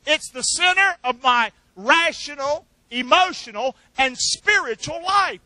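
congregation repeat building to a shout; preacher and crowd say it together, ending in a shout. Shown as a demonstration. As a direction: lead the crowd, rising to a shout on life. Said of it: "Would you say that with me?".

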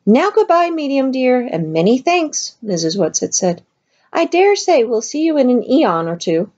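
high, hurried comforting; swift soothing. Acted. Missus Whatsit hurriedly soothes the upset medium, high-pitched. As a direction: rush in to soothe her friend.

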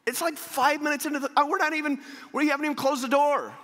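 high-pitched, comically alarmed outburst; flustered protest. Retold or imitated. Preacher replaying his panic on the plane, flustered protest. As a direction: blurt it out high in mock panic.

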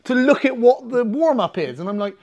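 usual speaking voice

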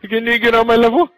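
taunting. Pretend. Repeated taunt.

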